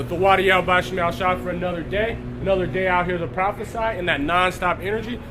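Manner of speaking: ordinary delivery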